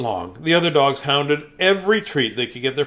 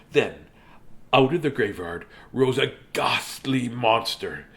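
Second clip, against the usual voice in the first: usual speaking voice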